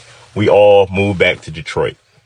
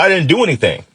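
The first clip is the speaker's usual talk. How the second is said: higher-pitched mild protest; retold protest; 7 semitones higher, faster than usual. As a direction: protest innocence on a raised pitch.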